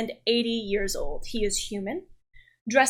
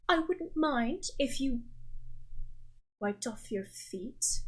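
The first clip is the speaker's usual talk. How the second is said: butler; 2 semitones higher, 9 dB quieter than usual. Acted. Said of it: "Bertram is the family's butler".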